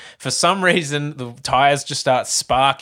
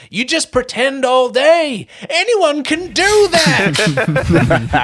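much higher, louder, very slow; mock bitter rant; 12 semitones higher, 4 dB louder, much slower than usual. Actor sneers at his rival, high, loud and slow. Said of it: "he goes".

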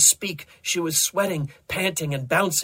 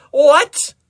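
loud, high, incredulous yelp; shocked outburst; 14 dB louder than usual. Acted. Desmond desynchronized too soon by Doctor Rex, shocked. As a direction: burst out in disbelief. Cut off at the climax.

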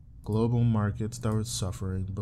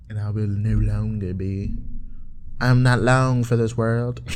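put-on country guy accent; 4 dB louder than usual. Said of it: "It's a country guy writing his Australian girl".

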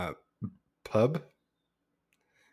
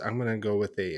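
normal talking voice

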